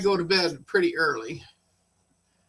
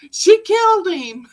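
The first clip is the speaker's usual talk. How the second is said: high, loud, laughing through the words; can't hold back a laugh; retelling the shocking phone call, laughing high and loud; laughing disbelief; 13 semitones higher, 8 dB louder than usual. Retold or imitated. Retold call, breaking into laughter.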